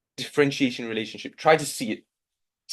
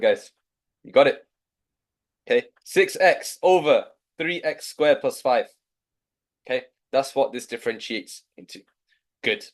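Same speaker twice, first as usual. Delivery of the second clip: normal talking voice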